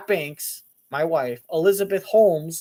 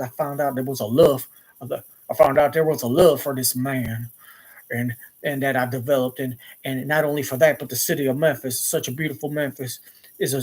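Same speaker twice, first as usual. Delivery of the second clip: talking like [name]; 3 semitones lower than usual. Retold or imitated. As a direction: answer as an actor stuck in an [name] voice. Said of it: "he was still talking like".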